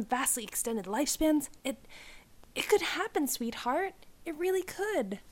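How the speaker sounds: delighted father, high and bright; excited dad. Acted. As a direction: gush over her like a proud dad. Dad's overjoyed voice in story.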